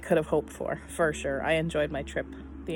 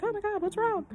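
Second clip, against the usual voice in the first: much higher, put-on voice of startled strangers; worried onlookers. Mocking strangers on the plane, high and alarmed. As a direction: mimic a fussing passenger, high and alarmed. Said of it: "the entire people to be like".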